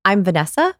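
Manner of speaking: "Vanessa" lifted as if asking; question inflection. Shown as a demonstration. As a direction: introduce yourself unsurely, rising on "Vanessa". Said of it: "So here's the difference".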